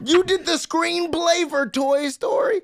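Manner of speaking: high, loud, drawn-out disbelief; incredulous shout. Real, not acted. Podcaster stunned at a writer's Toy Story screenplay credit, incredulous shout. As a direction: shriek it out slowly in amazement.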